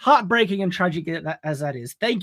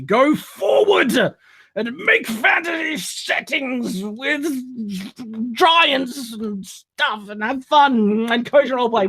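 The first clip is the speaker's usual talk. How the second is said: slow, higher, solemn benediction; grand blessing; 4 semitones higher, slower than usual. Acted. Host blessing a fan's series, slow grand benediction. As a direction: bless them like a noble lord.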